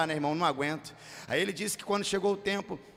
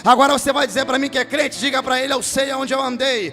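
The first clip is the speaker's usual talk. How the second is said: loud, high, half-sung revival preaching, shouting the climaxes; preaching at full force; 6 semitones higher, 13 dB louder than usual. Acted. Pastor to his congregation about being reached by grace, roaring sung preaching. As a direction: lift the church up and bellow the promise of grace.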